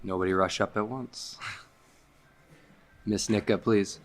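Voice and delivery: ordinary talk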